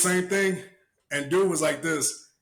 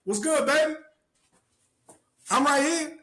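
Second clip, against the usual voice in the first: pitched-up mocking taunt; high taunting call; 9 semitones higher than usual. Outside the house, taunting the guy in a high voice. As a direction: taunt him sweetly from outside his house.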